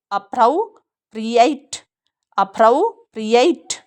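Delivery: अप्रोप्रिएट slowly, ending in eit; verb form. Shown as a demonstration. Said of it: "क्रिया है तो एट बोलेंगे". (क्रिया वाला उच्चारण)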